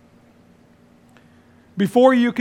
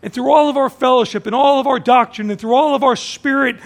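rising preaching; 5 semitones higher, 6 dB louder than usual. Acted. Building preaching climax.

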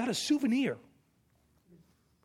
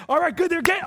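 higher and louder cheering; excited cheer; 8 semitones higher, 8 dB louder, much faster than usual. Retold or imitated. Parent cheering a child learning to ride a bike, excited. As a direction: cheer the kid on as he pedals. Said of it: "it's like".

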